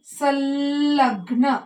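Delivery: संलग्न drawn out slowly, the ल sound held clearly; drawn-out demo pronunciation. Shown as a demonstration. Teacher showing pupils the ल sound, slow and drawn out. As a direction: stretch संलग्न so the ल is heard. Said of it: "कशाचा उच्चार जास्त ऐकायला येतो आपल्याला".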